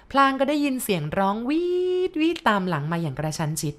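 plain reading voice

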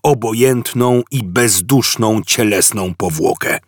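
pompous captain giving cold orders; a bored alien commander dismissing prisoners; Vogon captain. A narrator's reading. Captain condemning prisoners, cold pompous orders.

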